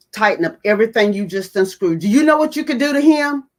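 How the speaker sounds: high and loud, rising urgency; alarmed demand. Retold or imitated. The individual scolding her over the unscrewed motorcycle, loud alarm. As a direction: bark the order in alarm. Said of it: "came over to me and said".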